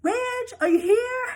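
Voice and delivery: high calling voice, searching for someone. Retold or imitated. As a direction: call out high, as if looking through the house. Calling for Ridge in retold scene.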